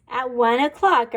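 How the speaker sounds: ordinary delivery, nothing marked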